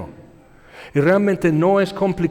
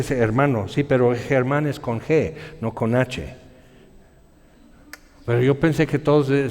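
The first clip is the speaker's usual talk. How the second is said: ordinary talk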